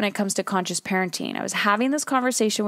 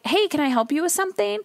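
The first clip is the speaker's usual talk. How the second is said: high, quick clerk's greeting; eager shop clerk; 9 semitones higher, faster than usual. Acted. Store owner coming over to a shopper, high and quick. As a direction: offer help in a lifted, brisk voice.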